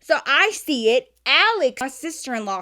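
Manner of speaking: ordinary delivery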